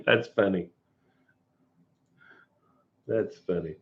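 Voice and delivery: ordinary delivery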